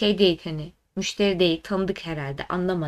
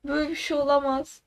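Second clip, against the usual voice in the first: worked-up whine; 5 semitones higher than usual. Real, not acted. To a friend after the bank story, exasperated whine. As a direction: groan it out, fed up. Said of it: "yine sinirlendim".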